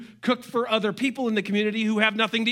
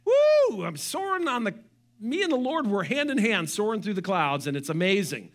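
giddy elation. Retold or imitated. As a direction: gush like you are flying with joy. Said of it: "How many of you have had".

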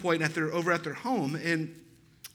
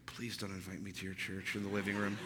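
much lower, much softer pleading aside; mock inner thought; 10 semitones lower, 13 dB quieter than usual. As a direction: mutter the unspoken thought under your breath. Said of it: "he's probably doing this".

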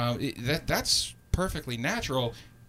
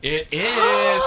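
excited greeting; 9 dB louder than usual. Real, not acted. Host greets guest arriving.

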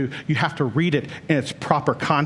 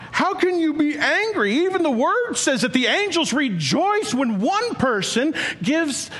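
preaching at full force; 12 semitones higher than usual. Acted. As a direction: thunder the question at the congregation. Preacher erupts at Jonah's anger.

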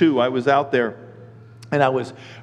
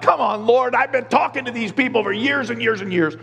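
higher, mock whiny complaint; whining; 7 semitones higher than usual. Pastor griping to God about his people, mock whining. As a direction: imitate griping at the Lord. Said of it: "I'm always whining to God".

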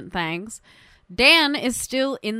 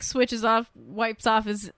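ordinary talk

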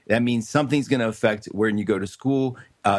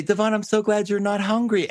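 higher, sugary teacher reply; sweet threat to a cheeky kid; sweetly pointed teacher; 9 semitones higher than usual. Retold or imitated. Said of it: "And I'd say".

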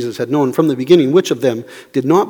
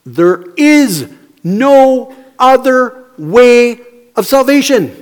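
slow, high, loud declaration; emphatic proclamation; 7 semitones higher, 5 dB louder, much slower than usual. Real, not acted. Preacher declaring the only way to the congregation, emphatic. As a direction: hammer each word out slowly.

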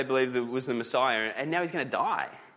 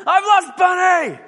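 put-on much higher and louder, slow wailing small child; panicked child's cry; 12 dB louder than usual. Young daughter waking at night calling her parents, panicked wail. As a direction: wail like a little girl who lost her toy. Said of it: "a cry of bewilderment and panic".

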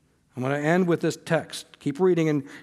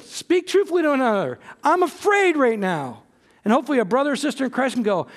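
impassioned preaching; 6 semitones higher than usual. Acted. Preacher at full force.

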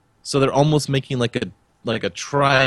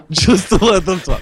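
higher, louder repeat through a laugh; amused higher, louder repeat; laughing emphasis; 7 semitones higher, 5 dB louder than usual. Real, not acted. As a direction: repeat it, tickled by the idea.

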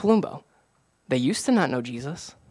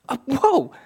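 acted amazement, pitched way up; mock freak-out; 11 semitones higher, 4 dB louder, slower than usual. Pastor retelling his reaction to new believers, high-pitched amazement. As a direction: reenact being blown away, voice shooting high. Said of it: "freaking out".

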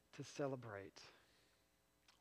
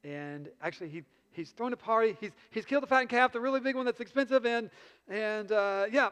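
hurried, louder nervous rambling; nervous stammering servant; 4 semitones higher, 5 dB louder than usual. Retold or imitated. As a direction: babble the news while backing away. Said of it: "backing away".

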